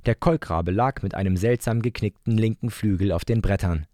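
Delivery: ordinary delivery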